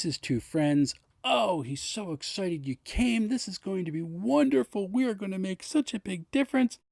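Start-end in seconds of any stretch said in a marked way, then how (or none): 1.2-6.8 beam with joy at the arrivals; gushing excitement. Acted. Gibeon greeting his two elf friends, high and gushing.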